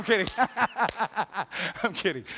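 laughing it off. Real, not acted. Pastor teasing a friend in the pews, laughing. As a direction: chuckle through the words. Joke about Shay, laughs.

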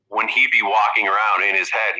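ordinary delivery

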